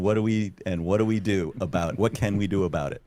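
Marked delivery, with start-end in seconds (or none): none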